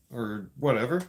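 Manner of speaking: normal talking voice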